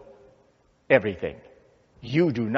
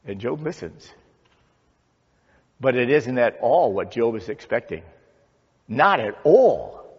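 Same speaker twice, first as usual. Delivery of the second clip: normal talking voice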